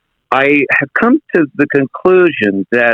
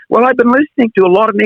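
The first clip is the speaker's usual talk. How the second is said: much higher, fast, light Canadian-tinged voice; Jordan Peterson impression; 11 semitones higher, faster than usual. Shown as a demonstration. Impressionist answers a compliment in the Canadian's high voice. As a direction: pitch it way up and quick like a high-voiced Canadian professor. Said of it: "He's got a very high voice".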